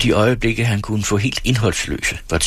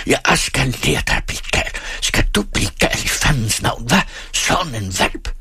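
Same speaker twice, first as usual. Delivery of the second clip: high, strained furious scolding; enraged father's outburst; fiery rage; 5 semitones higher than usual. A narrator's reading. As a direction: rail at him while landing blows. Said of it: "i fuld fyr og flamme".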